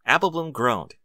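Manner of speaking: ordinary delivery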